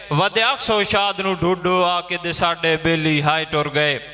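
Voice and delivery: high, sustained verse declamation; poem recitation. Acted. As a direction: recite it to the whole gathering. Poet recites verse at gathering.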